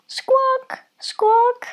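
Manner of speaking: very high squawking cries; bird squawks. A narrator's reading. Duckling flees over the fence into a bush, high squawks. Bird cry sound effect.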